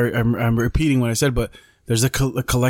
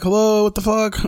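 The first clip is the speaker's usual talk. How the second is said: playful startled cry at the lights; mock startled outburst; 9 semitones higher than usual. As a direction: throw it out like the dark just spooked you. Waving at dead motion-sensor lights.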